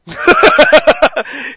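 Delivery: loud burst of real laughter. Real, not acted. Laughing at retold ram story.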